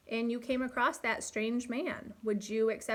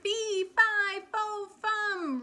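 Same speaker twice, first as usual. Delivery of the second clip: high mock giant roar, louder; hammed-up storybook giant bellowing; playful giant roar for kids; 10 semitones higher, 4 dB louder, much slower than usual. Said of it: "roared the giant".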